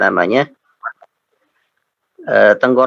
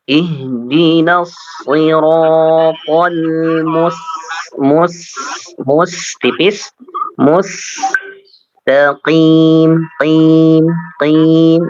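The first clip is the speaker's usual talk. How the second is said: slow chanted recitation of Iyyaka na'budu; Quran recitation; slower than usual. Shown as a demonstration. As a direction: recite slowly and melodically. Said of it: "teacher models recitation".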